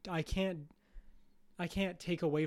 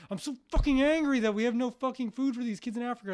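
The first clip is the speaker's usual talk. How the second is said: higher, louder mock rage with stress on angry; hammed-up fury; 7 semitones higher, 6 dB louder than usual. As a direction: rant like an overheated viewer. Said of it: "And you're like".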